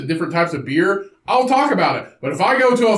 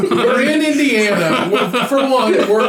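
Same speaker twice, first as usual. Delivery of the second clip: laughing; 3 semitones higher than usual. Real, not acted. Laughing at small-town moms.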